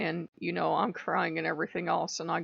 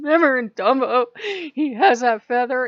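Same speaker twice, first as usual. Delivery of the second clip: louder, higher insistent explaining; 6 semitones higher, 10 dB louder than usual. Retold or imitated. Explaining the Dumbo feather to Loki, insistent. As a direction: push the point, exasperated. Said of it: "I said".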